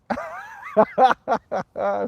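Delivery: high, helpless burst of laughter; real laugh. Real, not acted. Streamer laughs at a pasteurized milk pun, amused laughter.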